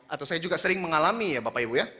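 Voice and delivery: normal talking voice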